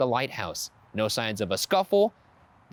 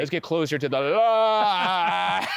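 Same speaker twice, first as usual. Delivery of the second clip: slow, loud, dreamy lure; entranced keeper; 5 semitones higher, 4 dB louder, slower than usual. Retold or imitated. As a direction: drift toward the light, slowly. Acting keepers lured to light.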